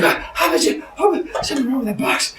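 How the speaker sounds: high, broken, gasped stammer; breathless shock. Acted. Woman after the box roared, gasping frightened stammer. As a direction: stammer in fright, voice thin on air.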